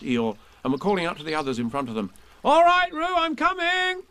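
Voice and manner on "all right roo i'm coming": much higher, loud reassuring call. Acted. Christopher Robin calling to Roo in the water. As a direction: shout across the stream. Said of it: "called Christopher Robin".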